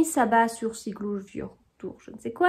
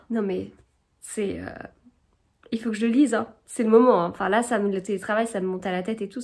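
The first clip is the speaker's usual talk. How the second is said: ordinary talk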